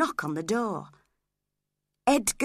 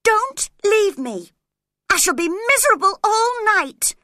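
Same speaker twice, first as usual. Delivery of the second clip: desperate plea; 14 semitones higher, 9 dB louder than usual. Acted. Catherine begging Edgar to stay, high desperate wail. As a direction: beg him not to go.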